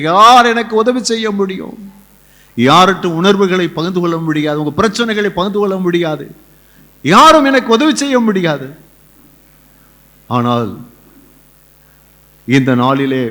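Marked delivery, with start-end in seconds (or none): 0.0-8.7 high, raised cry of helplessness; impassioned preaching. Acted. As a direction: preach it like a desperate plea. Preaches at full force. (உணர்ச்சிமிக்க உரத்த பிரசங்கம்)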